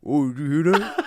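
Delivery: mock echo on the line; echo imitation. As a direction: parrot the caller like an echo. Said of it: "I was going like".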